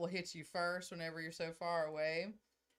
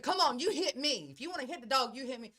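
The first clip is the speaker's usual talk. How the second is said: louder, higher in-your-face dare; confrontational challenge; 6 semitones higher, 6 dB louder than usual. Retold or imitated. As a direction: square up and dare him. Mom confronting tall son.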